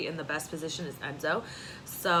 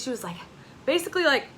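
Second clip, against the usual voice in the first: ordinary delivery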